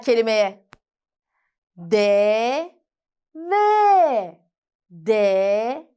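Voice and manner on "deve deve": sounding out words. Shown as a demonstration. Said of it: "Birleştiriyoruz".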